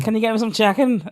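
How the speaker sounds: put-on Scouse request; Liverpool accent. Shown as a demonstration. Showing a mate how Liverpool sounds, put-on Scouse. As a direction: ask it in a playful Scouse accent. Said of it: "That's Liverpool, isn't it?".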